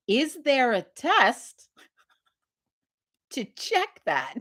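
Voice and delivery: high and very drawn-out question; halting, exaggeratedly slow query; slow, spelled-out asking. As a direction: lead him to it word by word. Retold slow questioning of doctor.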